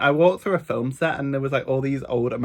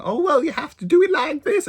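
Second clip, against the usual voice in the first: high, bossy American lady instructing; put-on American accent, pitched way up; mock American accent; 4 dB louder than usual. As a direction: imitate an American tourist giving orders. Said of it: "trying to tell him".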